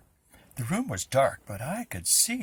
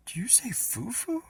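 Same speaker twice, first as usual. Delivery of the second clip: surprised; 10 semitones higher than usual. Acted. Amazed at the name.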